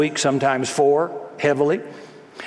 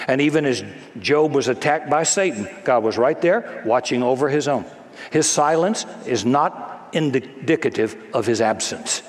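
usual speaking voice

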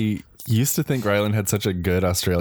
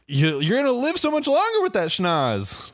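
very high put-on voice; dad's study. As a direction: pitch it way up, mock-encouraging a child. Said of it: "dad drafted this whole entire study".

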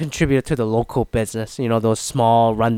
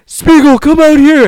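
shouted call, much higher and louder; crowd yell; 14 dB louder than usual. Retold or imitated. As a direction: yell for him to come outside. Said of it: "people are like".

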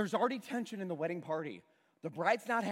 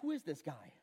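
mild muttered grumble, softer; grumbling; 5 semitones lower, 8 dB quieter than usual. Retold or imitated. Servants at the well grumbling about Jesus, muttering softly. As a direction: servants under their breath, lightly grumbling. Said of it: "grumbling about".

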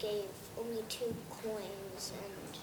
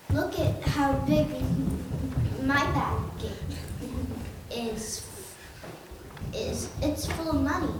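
bragging rich man; 3 semitones higher, 4 dB louder than usual. Retold or imitated. Child voicing a rich man boasting at the temple, louder brag. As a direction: show off your big bag of money. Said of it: "was bragging".

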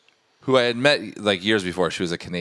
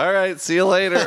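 very high, loud hurried goodbye; awkward exit; 13 semitones higher, 5 dB louder than usual. Retold or imitated. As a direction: squeak out a goodbye to escape. Embarrassed retreat after his gaffe.

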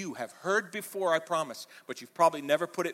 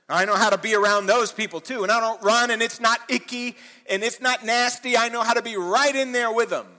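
high, loud, driving preacher voice; full-force preaching; 8 semitones higher, 10 dB louder than usual. Acted. Preacher pressing his flock, in full-force preaching. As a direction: preach it out to the congregation, building.

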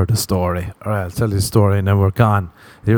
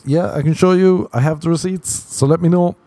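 higher, breezy and helpful; fake innocent son; 8 semitones higher than usual. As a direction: offer the receipts sweetly, voice up high. Pretend phone call to mom.

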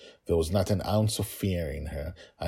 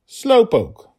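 put-on teasing child voice; 10 dB louder than usual. Echoes the character voice.

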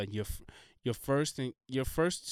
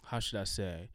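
ordinary talk, nothing marked

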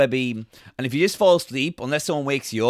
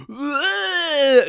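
mock cry; 13 semitones higher, 5 dB louder than usual. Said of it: "goes like".

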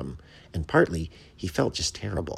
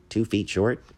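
higher, puzzled echo with a rising end; blankly; 5 semitones higher, 4 dB louder than usual. A narrator's reading. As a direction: ask it back, not understanding. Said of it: "The bat said blankly".

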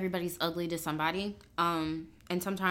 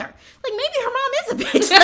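high giggling aside; laughing; 7 dB louder than usual. Real, not acted. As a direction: laugh through the words.

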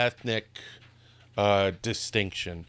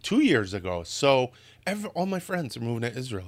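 ordinary talk, nothing marked

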